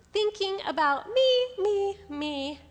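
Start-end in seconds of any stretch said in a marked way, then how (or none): none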